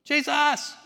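high, loud desperate call; beggar yelling. Retold or imitated. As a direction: call out to Jesus across the crowd. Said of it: "all he could do is yell".

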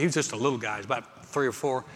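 usual speaking voice

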